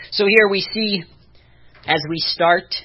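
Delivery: usual speaking voice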